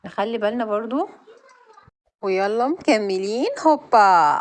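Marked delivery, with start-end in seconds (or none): 3.6-4.3 a loud "هوبا" as she jumps; loud jump cry. Real, not acted. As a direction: shout it out on the jump. Jumping in the game. (صيحة قفز عالية)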